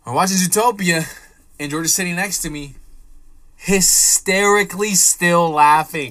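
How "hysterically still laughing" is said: "hysterically" drawn out slow and loud; loud, stretched stress on "hysterically"; emphatic storytelling. Retold or imitated. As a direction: hammer out "hysterically", slowly and loudly. Emphatic climax of his story.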